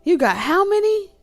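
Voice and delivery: slow hell no, then a shrill high yelp; scandalized disbelief, voice leaping up; indignant outrage. Acted. Rival counting the other's lead, outraged disbelief. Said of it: "that person's like".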